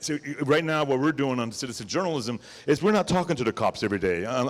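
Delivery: normal talking voice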